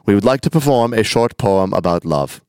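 mock German accent, stiffly formal announcement; hammed-up German recital. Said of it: "I don't know why they're German".

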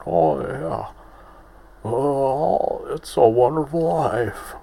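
terrible old-film-star impression; Dana Carvey's Jimmy Stewart. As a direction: wobble upward in a badly faked movie-star voice. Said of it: "Dana Carvey doing a terrible Jimmy Stewart".

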